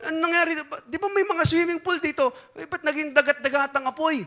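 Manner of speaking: high, loud, shocked complaining; high loud shocked politician; shocked outrage. Acted. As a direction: demand answers, loud and high, disbelieving. Politician shocked hell changed.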